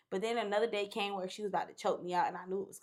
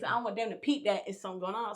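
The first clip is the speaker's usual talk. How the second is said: normal talking voice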